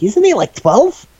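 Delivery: mock outrage at recruiting a kid; incredulous. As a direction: squeak out the disbelief that he's a child.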